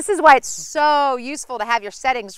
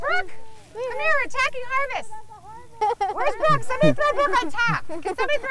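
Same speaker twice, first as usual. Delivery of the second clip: calling out; 11 semitones higher than usual. Real, not acted. Calling a girl over.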